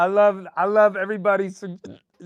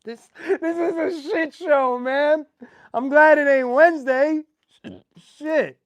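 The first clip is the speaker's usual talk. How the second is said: laughing; 8 semitones higher, 4 dB louder than usual. Real, not acted. Laughing fit continues.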